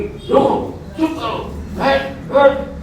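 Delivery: high-pitched curt commands, acted; passion's scolding orders. Inner passion ordering the self to sit, mock scolding. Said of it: "एक कसाई तो बोलेगी".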